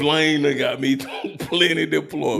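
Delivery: laughing confession. Real, not acted. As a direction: laugh your way through the admission. Repeats his confession, laughing.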